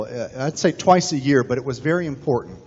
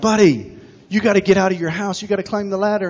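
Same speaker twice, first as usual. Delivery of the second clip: higher, urgent call from the helicopter; urging rescuer; 6 semitones higher than usual. Retold or imitated. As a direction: shout up to the roof. Helicopter crew urging him.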